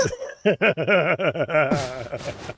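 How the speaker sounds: drawn-out amused laugh; real, lingering chuckle; long laugh. Real, not acted. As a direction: laugh at your own silly impression. Laughs after doing girl voice.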